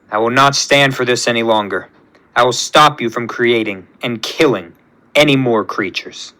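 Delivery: low, soft, firm vow; calm and determined; low quiet resolve. Acted. Hero quietly vowing to stop.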